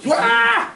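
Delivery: shrill high scream at a spider; a terrified woman's scream; scream of fright. Acted. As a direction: shriek as if a spider jumped out. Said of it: "Elle voit une araignée".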